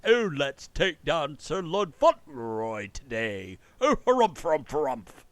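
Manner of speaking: slow, pompous mock English aristocrat, the harumphs squeaking up high; stuck-up Englishman accent. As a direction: ham up a snooty English lord plotting revenge. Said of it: "That's your stuck-up Englishman accent".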